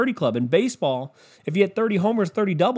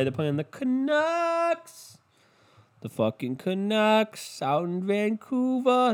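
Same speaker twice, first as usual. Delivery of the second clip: drawn-out mock; 6 semitones higher, much slower than usual.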